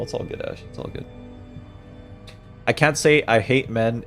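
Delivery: usual speaking voice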